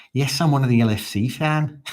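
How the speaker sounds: high, loud put-on fan voice; hammed-up voice of a fan; put-on voice reading comment. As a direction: read the comment in a silly voice.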